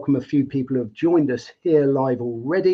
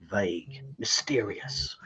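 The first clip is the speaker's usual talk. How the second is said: lower, much softer spooky hush; mock mysterious; 6 semitones lower, 10 dB quieter, slower than usual. Host reading a viewer's comment in a mock mysterious hush. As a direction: drop the voice like telling a secret.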